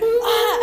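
high, loud mock shriek of shock; startled yelp. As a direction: yelp like he's just seen something gross and bolts. Boyfriend recoils at the sight.

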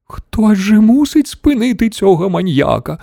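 high, croaky granny outburst; croaking old woman. A narrator's reading. Grandmother demanding someone stop the maniac, high croak. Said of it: "прохрипіла бабуся Джозефіна".

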